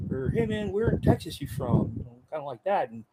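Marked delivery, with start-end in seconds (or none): 0.3-1.9 much higher, friendly question; asking a platoon mate where in Texas he's from, pitched high; casual teasing guess. Retold or imitated. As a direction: call out a buddy's accent.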